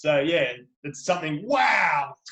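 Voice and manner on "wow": louder, higher demonstrated wow; mock amazed. Shown as a demonstration. Author explaining what crikey means, mock amazed. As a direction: exclaim it as if amazed. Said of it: "like you're a bit shocked".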